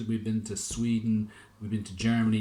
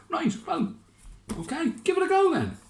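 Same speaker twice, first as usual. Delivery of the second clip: very high, loud approving mayor; mayor's voice pitched far up; Lord Mayor; 5 dB louder than usual. Acted. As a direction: squeak your approval. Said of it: "said the Lord Mayor".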